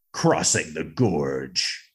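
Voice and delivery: slow, low, drawn-out scene title; dramatic title announcement. Pretend. Podcast host grandly naming a favorite scene, dramatic announcement.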